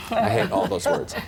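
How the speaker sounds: amused mock complaint; laughing. Real, not acted. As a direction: laugh off the buzzwords. Laughing at his own gripe.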